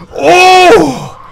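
panting gasp and exhale; shaky relieved gasps. Real, not acted. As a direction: catch your breath after terror. After the chase.

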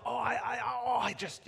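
lightly voiced Peter bursting to speak; a hint of a fidgety disciple; eager Peter. Peter itching to interrupt Jesus, lightly voiced eagerness. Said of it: "Peter feels".

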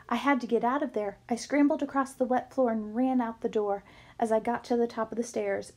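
plain reading voice

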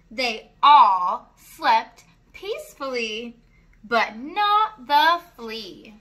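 sing-song rhyming read-aloud; reading to children. A narrator's reading. Reading a rhyming farm book to children, sing-song. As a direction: lilt each rhyme for little listeners.

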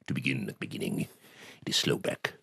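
low, hushed recitation; Richard Burton. Acted. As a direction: recite very softly and low, with weighty pauses. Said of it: "Richard Burton, you know, doing".

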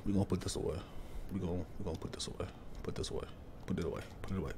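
barely audible, hushed repeats; scared whisper. Real, not acted. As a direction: whisper so nothing hears you. Hiding from monster in game.

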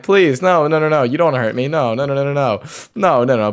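half-joking desperate pleas to attacking enemies; panicked pleading. Real, not acted. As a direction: talk them down in a rush of fear. Begging hostile piglins not to attack.

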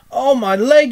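high, loud cry of pain; pained squeal. A narrator's reading. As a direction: squeal out in sudden hurt. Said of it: "with a squeal".

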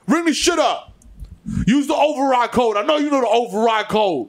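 high-pitched, hammed-up scolding; mock irate customer. Irate customer telling a cashier to use the override code, mock exasperated. Acted berating of a store cashier.

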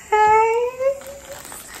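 very high, loud squeal; happy squeal. Real, not acted. Vlogger to viewers, overjoyed at the shoes, squealing. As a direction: squeal with joy. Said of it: "I'm so happy".

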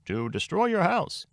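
very high, slow lawyer voice; Mister Troubleson. A narrator's reading. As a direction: deliver it high and drawn out, as a lawyer character. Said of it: "Mister Troubleson completed the sentence".

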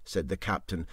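ordinary delivery

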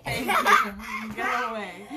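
laughing. Real, not acted. Laughing at child interrupting.

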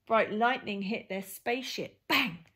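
usual speaking voice

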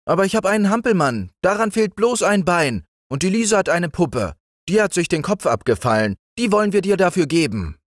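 hoch, hastig und schnell; eifriges Kind. A narrator's reading. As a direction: sprudelnd anbieten wie ein Kind. (Brüderlein bietet eifrig seine Spielsachen an, schnell und hoch)

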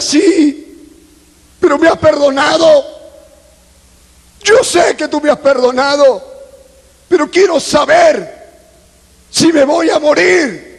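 high, insistent pleading; anguished plea. Retold or imitated. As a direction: beg God urgently for an answer. Ruega saber si morirá. (súplica angustiada, en tono agudo)

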